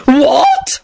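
high, rising screech; outraged high-pitched shriek. A narrator's reading. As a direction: screech it in disbelief. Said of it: "Lady Fanshawe screeched".

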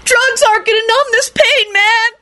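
very high, tearful shriek. A narrator's reading. Kenny refuses comfort, shrieking. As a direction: shriek it in theatrical agony. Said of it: "Kenny shrieked".

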